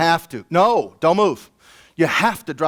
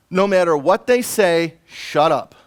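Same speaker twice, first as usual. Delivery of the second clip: ordinary delivery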